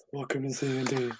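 soft, quick put-on hostess; female character voice. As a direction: welcome listeners in a hushed mock-female voice. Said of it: "I should really make that a character".

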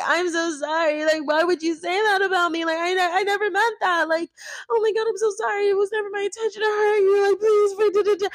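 very high, whiny, hammed-up sorry; mock victim voice. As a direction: overplay the victim, pleading. Playing victim.

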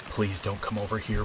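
soft anxious murmur; quietly pleading to herself; inner voice. Acted. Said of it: "I repeated in my mind".

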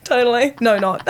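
high nervous giggling; nervous laugh. Real, not acted. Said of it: "There's that nervous laugh again".

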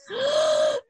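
sharp high gasp; frightened gasp. Real, not acted. Gasp at ghost ear in photo.

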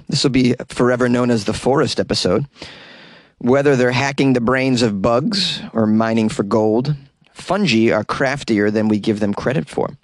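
ordinary talk, nothing marked

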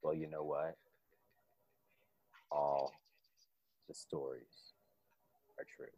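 very low, soft menace; menacing low voice. Acted. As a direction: growl it like a villain.

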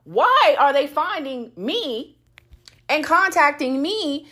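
demanding answers, voice up high; angry rant. Real, not acted. Ranting at the cheating husband.

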